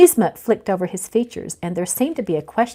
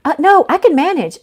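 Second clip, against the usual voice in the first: high, loud blurt of refusal; hasty loud refusal, much higher; 9 semitones higher, 7 dB louder than usual. Acted. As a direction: blurt it out in a panic. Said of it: "Alex blurted".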